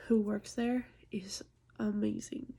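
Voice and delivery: normal talking voice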